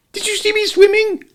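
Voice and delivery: excited squeak. A narrator's reading. Roo boasting after climbing out, excited squeak. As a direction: squeak with pride.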